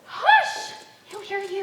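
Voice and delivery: loud, high shushing; sharp high hush. Acted. Afraid he will overhear.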